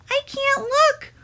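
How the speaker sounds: put-on high, slow whimpering girl voice; whimpering little sister. A narrator's reading. Sister whimpers, eyes shut, at the mess, high and slow. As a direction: peep it out, too upset to look. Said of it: "whimpered his sister Allison".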